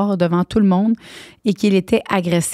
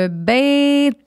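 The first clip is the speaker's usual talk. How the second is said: gleeful cheer; 6 semitones higher, much slower than usual. Real, not acted.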